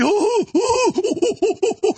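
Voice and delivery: high, put-on gloating laugh; mocking laugh. Imitating critics cackling at him, high mock laugh. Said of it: "I'm sure the clips will be".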